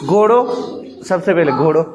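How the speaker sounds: normal talking voice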